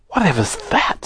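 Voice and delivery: high-pitched startled question; a touch of alarmed wonder. A narrator's reading. Bessie woken by the owl's screech, startled.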